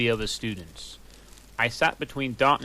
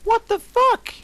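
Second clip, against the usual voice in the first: much higher, louder startled cry; shrill shocked question; 9 dB louder than usual. A narrator's reading. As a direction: shriek in shock at the fight. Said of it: "I asked".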